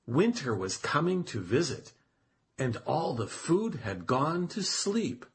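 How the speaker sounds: ordinary delivery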